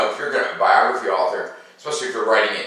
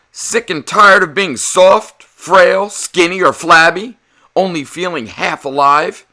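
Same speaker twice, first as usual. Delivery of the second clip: loud, higher salesman voice; ad pitch; 8 semitones higher, 9 dB louder than usual. Acted. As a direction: sell it like an old magazine ad. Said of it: "Atlas asked in an advertisement".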